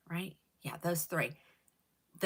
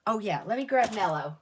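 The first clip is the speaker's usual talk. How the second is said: ordinary talk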